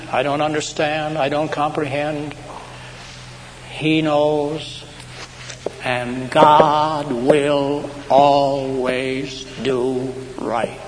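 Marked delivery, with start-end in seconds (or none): 3.7-10.8 very slow, drawn-out declaration; solemn assurance. Real, not acted. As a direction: stretch each word out with conviction. Preacher's solemn assurance of faith.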